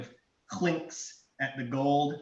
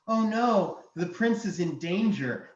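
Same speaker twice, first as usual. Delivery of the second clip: high, alarmed princess; 5 semitones higher, 3 dB louder than usual. Acted. Dragon imitating the princess for the party, princess voice. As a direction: cry out like a princess in distress. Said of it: "he says in the voice of the princess".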